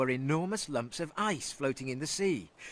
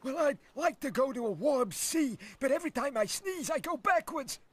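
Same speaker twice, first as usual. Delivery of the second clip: high, stuffy sniffly whale; a sniffly whale with a cold; 11 semitones higher than usual. Acted. Brad voice.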